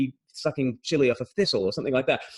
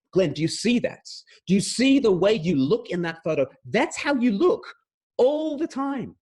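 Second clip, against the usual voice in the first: imitating an insistent wife, pitch climbing; lightly voiced blunt wife; 7 semitones higher than usual. Wife showing husband his photo, mock insistent. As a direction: drive the point home in a higher voice. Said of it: "She will say".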